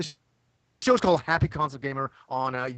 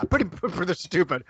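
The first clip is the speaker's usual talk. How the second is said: usual speaking voice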